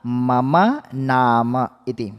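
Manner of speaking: very slow, careful recitation of मम नाम इति; slow demonstration. Shown as a demonstration. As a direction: spell it out slowly for the class. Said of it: "कैसे परिचय किया था?".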